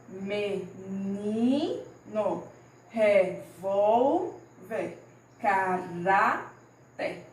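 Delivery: very slow Menino, revolver, caráter, stretching NI, VOL and RA; "Menino, revolver, caráter" chanted slowly in syllables, penultimate held; stressed penultimate syllable. Shown as a demonstration. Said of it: "a sílaba que tá com mais intensidade".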